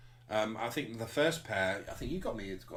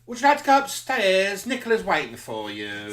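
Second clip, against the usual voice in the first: high, loud mock receptionist purr; seedy receptionist; 9 semitones higher, 11 dB louder than usual. Receptionist welcoming a client, high and hammed-up. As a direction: play the sleazy receptionist greeting a client.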